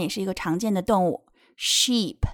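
normal talking voice

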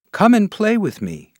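carefree grasshopper. Acted. Grasshopper invites the busy ant.

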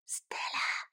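soft, breath-only call; whisper. A narrator's reading. Sam calls sleepy Stella at night, whisper. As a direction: whisper her name so nobody wakes. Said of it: "whispered Sam".

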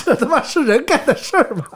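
laughing through an outraged question; half laugh, half disbelief. Real, not acted. Host laughing at cruel deed. (边笑边骂)

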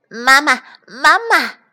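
put-on high, loud baby duckling cry; calling duckling. A narrator's reading. Newborn duckling rushing at the fox, calling loudly. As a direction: call out for mama in a high little voice. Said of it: "叫着".

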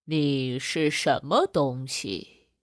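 a big lion eyeing its lunch; lion's question. Acted. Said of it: "狮子舔舔嘴问道". (低沉缓慢的狮子声音)

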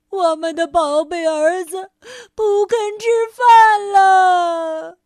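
high, loud, slow hammed-up sobbing; comic tearful lament; wailing cry. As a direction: wail it like heartbroken crocodile parents. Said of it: "伤心地喊道".

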